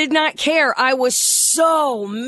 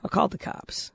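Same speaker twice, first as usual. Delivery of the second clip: whispering; 2 semitones lower, 9 dB quieter than usual. Real, not acted. Confessing a secret, leaning in.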